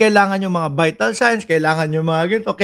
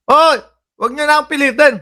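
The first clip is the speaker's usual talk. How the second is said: sudden high, loud mock yell; mock protest; 9 semitones higher, 3 dB louder than usual. Commentator mocking a senator's refusal, high mock yell. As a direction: shout it like a cornered senator brushing them off.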